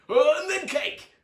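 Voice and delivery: high, loud eager voice; hungry jolly uncle. A narrator's reading. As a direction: Uncle Merriweather asks for cake, loud eager. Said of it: "he added".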